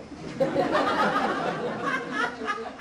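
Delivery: real amused laugh; breathy laugh. Real, not acted. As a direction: laugh at the joke on yourself. Laughs at his own story.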